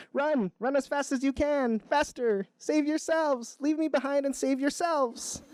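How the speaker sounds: high-pitched urgent turtle cry; mock panicked shout. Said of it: "the turtle shouts".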